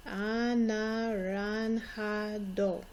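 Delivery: "Anaranjado" drawn out slowly, J like an H, "ah-nah-rahn-HAH-doh"; slow model word. Shown as a demonstration. Teacher slowly modelling "Anaranjado" for pupils to repeat. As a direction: stretch each syllable of "Anaranjado" for the kids. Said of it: "Notice the J sounds like an H".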